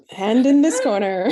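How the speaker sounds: sports announcer voice. Pretend. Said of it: "in the sportscaster announcer voice".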